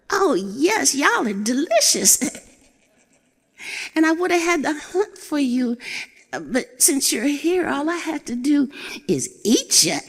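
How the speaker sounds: high, bright put-on bluster; an old goat puffing up to scare a cat; scheming old goat. Old goat bluffing the wildcat about her birthday, high and boastful.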